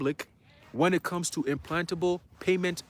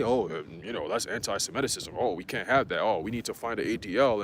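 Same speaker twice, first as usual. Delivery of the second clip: lower, a mock whiny opponent; mocking the critics; 7 semitones lower than usual. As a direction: sneer it out as the debunkers. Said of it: "caves to come and debunk and say".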